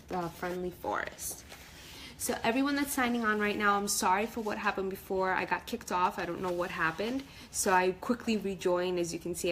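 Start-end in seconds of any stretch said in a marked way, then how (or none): none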